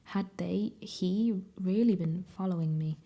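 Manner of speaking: ordinary delivery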